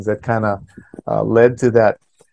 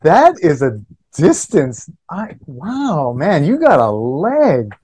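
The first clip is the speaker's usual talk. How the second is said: drawn-out, higher-pitched amazement; amazed; 3 semitones higher, slower than usual. Real, not acted. Host marvels at the guest's forty-eight yard kick, amazed. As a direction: stretch the words out in impressed wonder.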